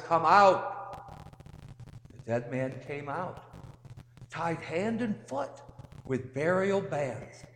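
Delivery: measured, solemn gospel reading voice; scripture reading. Acted. Priest reading the gospel to the congregation, solemn.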